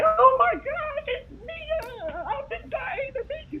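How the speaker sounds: high, teary gushing fan voice; mock crying fan. A fan meeting Nia, mock sobbing and high. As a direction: play an overwhelmed fan near tears. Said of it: "I don't want nobody to be like".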